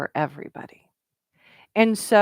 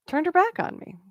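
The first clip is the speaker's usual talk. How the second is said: pitched much higher in disbelief; incredulous repeat; 9 semitones higher than usual. Retold or imitated. Retelling being snubbed while networking, repeated in high disbelief. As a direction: echo it again, stunned that anyone would do that.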